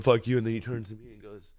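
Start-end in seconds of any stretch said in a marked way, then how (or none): none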